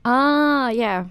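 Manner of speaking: normal talking voice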